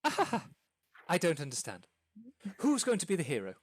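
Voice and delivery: fast, excited stammering impresario; lightly voiced flustered theatre manager; excited hostess. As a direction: babble the plan out breathlessly. GM voicing hostess casting roles.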